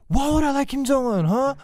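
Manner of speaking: a hint of an offended grandma; mock angry grandma. Grandma indignant at the Kim Jong-un question, mild mock anger. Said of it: "she gets so mad".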